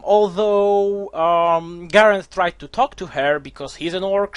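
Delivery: ordinary delivery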